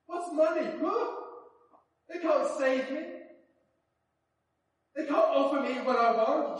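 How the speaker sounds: high, loud rhetorical challenge; emphatic, exultant brush-off; scornful dismissal. Acted. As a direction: toss it off as worthless, with rising fervour. Acts out convert scorning money.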